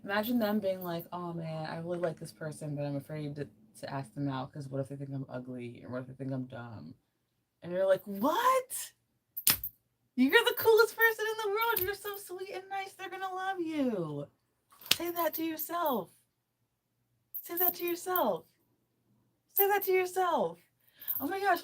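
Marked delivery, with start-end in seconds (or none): 1.1-6.9 soft, quickening mumble of self-doubt; insecure friend. Acted. Best friend afraid to ask someone out, insecure. 8.0-14.3 very high, squealing disbelief; incredulous hype friend. Acted. To a best friend who feels ugly, incredulous gushing. 15.0-20.6 very high-pitched insistent coaching; squeaky urging. Pretend. Telling listeners to say it to yourself, squeaky urging.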